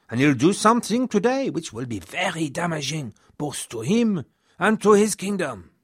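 lightly voiced Robert-like lament, pitch climbing at the end; distressed exclamation. A narrator's reading. Robert exclaiming his fears to the chronicler, mildly distressed. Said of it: "threw himself onto a bed and exclaimed".

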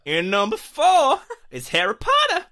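put-on high, hollering cowboy accent. Host announcing the ranking, whooping cowboy voice. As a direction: whoop it up like a loud, hammed-up cowboy. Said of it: "cowboy voice".

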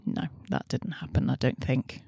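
breathy low mutter; whispering. Real, not acted. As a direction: murmur it to yourself. Muttering doubt while searching.

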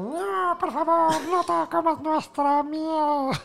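high, slow tiny bee voices; pleading bees. Acted. Bees begging the hero not to take honey, high and slow. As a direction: stretch it out like a swarm of tiny bees.

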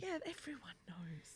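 hushed aside; under the breath, unvoiced; whispering. Real, not acted. Aside under host intro.

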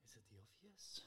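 whisper. Real, not acted. Contestant psyching out quizmaster.

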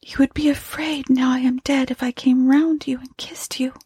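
high, whispery, faint voice; hollow, wistful murmur from the dead; weary ghost. Acted. Dead wife wonders about kissing her husband, wearily. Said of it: "she said wearily".

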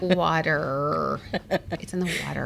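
lightly imitating the Long Island accent; mock Long Island accent. Teasing her partner's Long Island "water". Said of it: "You were like".

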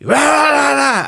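high, loud mock roar; imitating the massive guy's yell; retold scream. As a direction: belt out a wordless rage. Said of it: "just screamed".

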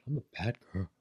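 sad little pet voice, hushed; dog voice. Pretend. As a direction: voice the guilty dog softly. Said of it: "That was our dog voice for Jenna".

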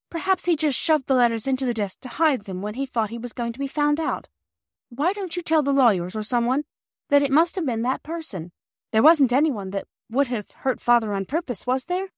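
put-on high, eager child's voice; anxious child Bobby. A narrator's reading. Voices Bobby questioning Mother.

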